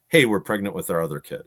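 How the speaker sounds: usual speaking voice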